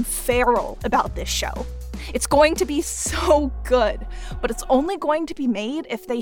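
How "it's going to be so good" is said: ordinary talk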